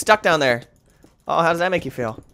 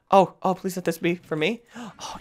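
normal talking voice

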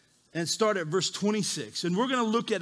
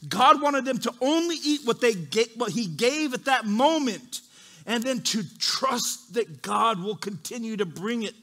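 usual speaking voice

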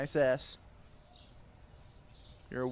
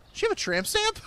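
high, amused, loud ask; excited teasing question; 5 dB louder than usual. Real, not acted. Shopkeeper teasing a customer about tattoos, high and gleeful.

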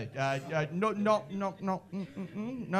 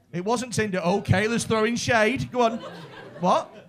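ordinary delivery

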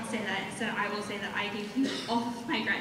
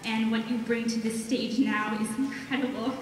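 ordinary delivery

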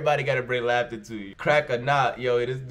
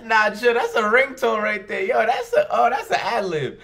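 higher and louder, laughing through the words; amused shouts between laughs; laughing hard; 9 semitones higher, 6 dB louder than usual. Real, not acted. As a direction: crack up in disbelief. Cracking up at the clip.

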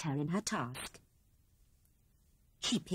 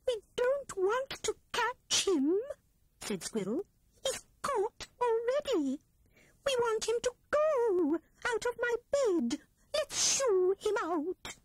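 much higher, eager little squirrel voice; anxious Squirrel. A narrator's reading. Squirrel urges her friends to shoo Rat from her bed, high and anxious. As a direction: plead to get the rat gone.

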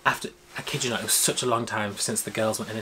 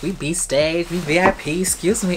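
higher, louder smug voice; acting cool; 6 semitones higher, 6 dB louder, slower than usual. Fan showing wristbands to the guard, smugly cool. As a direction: play it cool and entitled. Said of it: "I was acting all cool".

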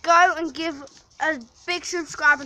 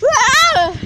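imitated scream; 10 semitones higher, 8 dB louder than usual. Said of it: "so he was like".

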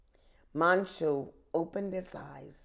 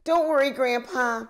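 proudly; 6 semitones higher, 7 dB louder than usual. Acted. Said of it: "Manchu said proudly".

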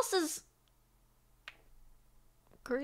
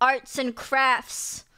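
slow drawn-out; much slower than usual. Pretend. Drawn-out repeat.

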